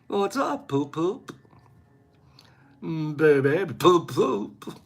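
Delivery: softly crooned silly pet names; mock romantic. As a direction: croon it like a lover.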